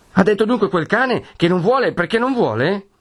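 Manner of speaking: put-on young man seething at the tyrant; high scornful protest; angry outburst. A narrator's reading. Renzo's angry outburst continues.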